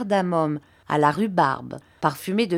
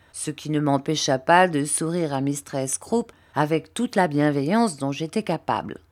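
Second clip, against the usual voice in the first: ordinary delivery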